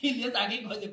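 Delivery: ordinary talk, nothing marked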